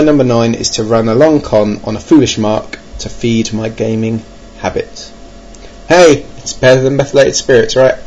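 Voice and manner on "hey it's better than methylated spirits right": usual speaking voice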